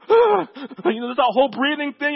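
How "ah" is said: high, loud breathless sob; a baby catching her breath after crying; high, loud gasping cry; toddler gasp. Retold or imitated. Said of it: "She's like".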